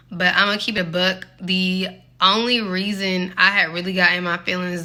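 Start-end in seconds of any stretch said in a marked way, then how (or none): none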